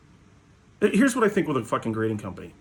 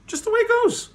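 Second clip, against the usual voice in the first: sing-song high repeat; 3 dB louder than usual. Shown as a demonstration. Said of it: "repeated for effect".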